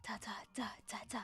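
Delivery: whispered da da. Pretend. Hums a dramatic reveal tune.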